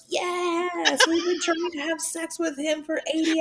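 high-pitched exasperated cheer, retold; excited. Friends celebrating a long-sought achievement, excited. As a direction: re-enact the friends' high, elated outburst.

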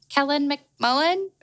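imitating her unsure self, "Kellen McMullen?" lifted up into a question; name said as a question. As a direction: make "Kellen McMullen?" sound like you doubt your own name. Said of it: "I shouldn't be saying that like a question".

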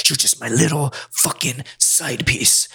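slow, deliberate mock menace; imitated slow domineering put-down; menacing taunt. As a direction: draw each word out, taunting. Retelling woman's line while choking him.

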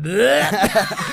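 hungover retch. Retold or imitated. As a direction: fake being sick, laughing. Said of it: "little did they know you were like".

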